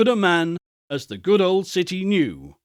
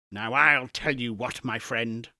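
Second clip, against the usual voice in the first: low, softer mock-stern old miser; mock-stern Scrooge, hushed; 5 semitones lower, 5 dB quieter than usual. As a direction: play Scrooge pretending to be cross, pulled down and quiet. Scrooge feigning sternness to Bob.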